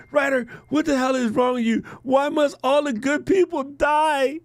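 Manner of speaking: mock crying. Quoting crying fan begging writer, mock sobbing. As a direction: wail like a heartbroken fan. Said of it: "She's like".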